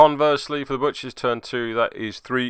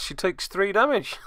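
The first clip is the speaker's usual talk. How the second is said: breaking into delighted laughter; laughing; 13 semitones higher than usual. Real, not acted. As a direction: laugh through the words, then a high cackle. Laughs at game outcome.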